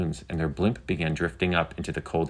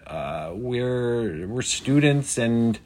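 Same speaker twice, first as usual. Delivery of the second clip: higher, drawn-out nervous hesitation; stuttering; 6 semitones higher, much slower than usual. A narrator's reading. Riven answers the FAA drone, stuttering. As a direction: stall and trip over the start. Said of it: "Riven stuttered".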